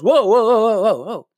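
rapid-fire mock alarm; startled passerby. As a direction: act out a rat halted by the racket. Passing rat reacts to screaming.

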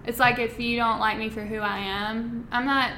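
usual speaking voice

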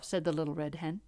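ordinary delivery, nothing marked

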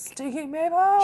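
puzzled squeak at a strange name; confused mishearing. Retold or imitated. Betty mishears, confused quote.

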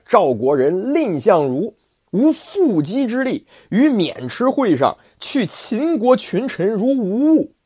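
高亢洪亮、放慢的武将口吻; 像关公一样傲气十足地拍板; 关二爷傲然. Acted. 关羽引古例. (关羽对儿子关平豪言，高亢傲然)

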